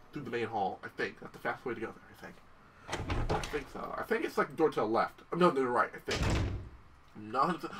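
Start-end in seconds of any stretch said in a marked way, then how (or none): none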